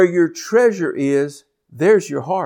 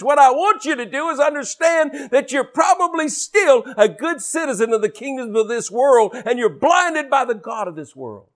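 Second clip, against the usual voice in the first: preaching at full force; 7 semitones higher than usual. Acted. Preacher warns the congregation about debt, fervent. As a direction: drive it at the congregation hard.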